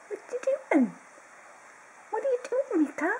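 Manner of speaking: very high sing-song pet voice. Real, not acted. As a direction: coo at the puppy, curious. High pet talk to the dog.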